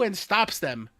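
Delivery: usual speaking voice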